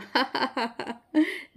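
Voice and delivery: short amused giggle; light giggle. Acted. Sister-in-law giggles at the bride's remark, amused giggle. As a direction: giggle at the bride's innocent words. Sonam laughs at Payal.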